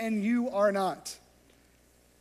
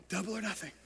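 quiet refrain; 8 dB quieter, much faster than usual. Acted.

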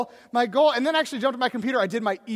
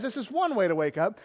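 ordinary talk, nothing marked

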